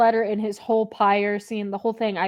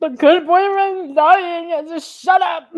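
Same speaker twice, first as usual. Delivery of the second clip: high, loud, slow hammed-up wail; mock sobbing outburst; 8 semitones higher, 5 dB louder, slower than usual. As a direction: ham up a tearful protest. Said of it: "And you're like".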